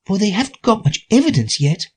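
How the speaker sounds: put-on high girlish voice; Alice voice. A narrator's reading. Alice to herself.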